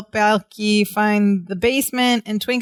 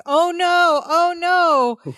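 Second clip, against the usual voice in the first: fake panic; 8 semitones higher, much slower than usual. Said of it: "he's like".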